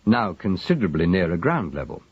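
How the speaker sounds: plain reading voice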